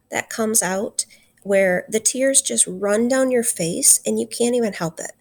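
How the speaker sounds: normal talking voice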